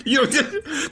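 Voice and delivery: high laughter through you know; giggling, words half-swallowed; laughing. Real, not acted. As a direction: break into a laugh.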